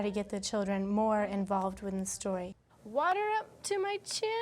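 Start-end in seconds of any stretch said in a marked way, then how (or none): 2.8-4.3 put-on high, eager child voice; Henry's voice. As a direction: squeak it out like little Henry. Reading Henry's line aloud.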